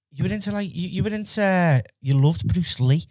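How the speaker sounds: ordinary talk, nothing marked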